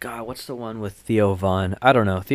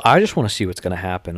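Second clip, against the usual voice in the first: ordinary talk, nothing marked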